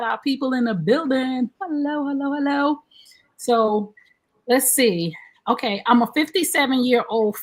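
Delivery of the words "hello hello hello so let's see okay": usual speaking voice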